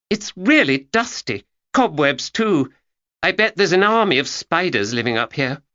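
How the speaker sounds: a hint of a curious young girl; young Georgia voiced. Girl on a dusty stepladder, lightly girlish.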